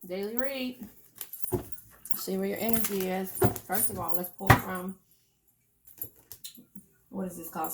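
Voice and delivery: talking while cracking sunflower seeds; eating sunflower seeds. Real, not acted. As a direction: speak around a mouthful of seeds. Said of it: "Sorry, I'm eating sunflower seeds".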